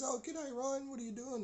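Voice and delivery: high, sing-out neighbourly greeting; friendly neighbour. Retold or imitated. Neighbour greets Ryan waiting outside, friendly high voice.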